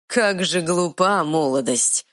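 plain reading voice

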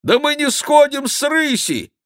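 high-pitched monk's protest; indignant monk. A narrator's reading. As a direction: object in an outraged high voice. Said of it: "возмутился монах". (высокий возмущённый голос монаха)

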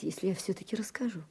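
whispered plea. Acted. Wife asks permission, hushed.

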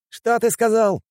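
very high outraged yell. A narrator's reading. Fighter who overheard a taunt, yelling. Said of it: "завопил он".

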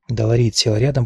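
ordinary delivery

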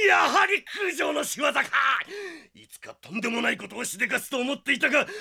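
a teacher who always knew it; outraged teacher shout. Acted. Teacher shouting blame at Jotaro.

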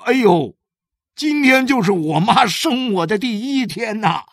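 high, loud cry of sudden realization; father bursting out amazed; exclaiming in surprise. A narrator's reading. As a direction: exclaim it loudly as it dawns. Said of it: "他一拍脑袋叫了起来".

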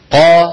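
قا with a bouncing qaf echo; loud echoing قا from the throat; qalqala on qaf. Shown as a demonstration. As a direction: sing out قا with a ringing echo on the qaf. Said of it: "قولوا مثلي لو سمحتم".

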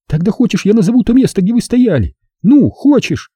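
put-on high-pitched girl character, insistent; defiant girl. A narrator's reading. Zaza tells how she followed them, defiant. Voices Zaza challenging narrator.

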